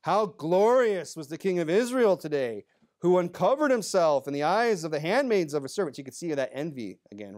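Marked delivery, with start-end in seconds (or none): 0.0-6.0 emphatic, cutting fake praise; thick sarcasm. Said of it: "a very thick layer of sarcasm".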